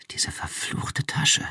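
hissed under her breath, slow; hissed whisper. A narrator's reading. Woman hissing at her jammed handbag in a whisper. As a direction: spit the words out in a whisper. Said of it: "zischte sie".

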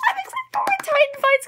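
high, giddy fan squeal; squealing excitement. Real, not acted. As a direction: say it again as a fangirl shriek. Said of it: "I'm so excited".

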